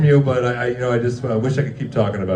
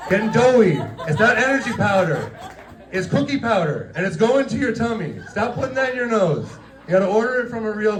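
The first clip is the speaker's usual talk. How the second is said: put-on higher, like telling off a naughty kid; teasing telling-off pitched up high; mock scolding; 7 semitones higher than usual. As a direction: scold him like a toddler caught in the cookie jar. Scolding John about the flour.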